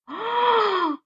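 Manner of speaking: quick high gasp of suspense; storyteller's surprised intake of breath; sharp dramatic gasp. Acted. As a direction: gasp as if something's about to happen. Pepper fleck reaches bear.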